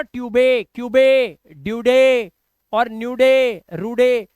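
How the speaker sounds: ट्यूबे, क्यूबे, ड्यूडे, न्यूडे, रूडे with loud 'ay' endings; loud misreadings क्यूबे, रूडे, final E drawn out as 'ay'; wrong voiced final E. Shown as a demonstration. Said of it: "आप ये मत बोलना".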